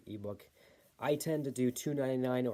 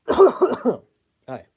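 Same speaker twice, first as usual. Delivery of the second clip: squeaky hi; 11 semitones higher, 17 dB louder than usual. Real, not acted. Squeaky hi after cough.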